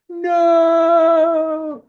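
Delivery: very high, loud, long "no"; hammed-up anguished scream. Orpheus loses Eurydice forever, loud mock anguished wail. Acted cry as she is lost.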